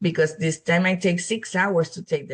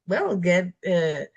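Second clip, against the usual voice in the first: usual speaking voice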